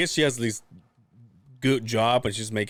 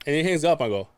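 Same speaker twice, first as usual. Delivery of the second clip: ordinary delivery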